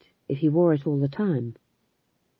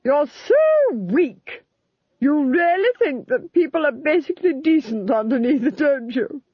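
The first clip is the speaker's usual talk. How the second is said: contemptuous Duchess; 9 semitones higher, 4 dB louder than usual. Acted. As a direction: draw out the insult with contempt. Duchess voice held.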